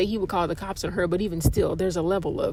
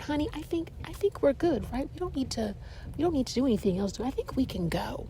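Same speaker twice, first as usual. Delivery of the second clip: softer, hushed voice to her husband; imitated whisper; 3 semitones higher, 6 dB quieter, faster than usual. Wife quietly asking her husband if they can leave, hushed. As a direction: murmur it so nobody else hears. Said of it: "the lady whispered".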